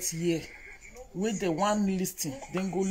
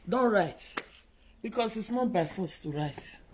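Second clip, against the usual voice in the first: ordinary delivery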